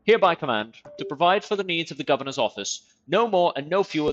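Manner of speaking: pompous official proclamation; formal decree read. Acted. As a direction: read it like a herald announcing a royal decree. Reads in-game decree aloud.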